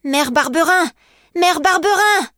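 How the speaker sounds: put-on high, distressed boy's call; desperate child's cry. Sobbing boy calls for foster mother.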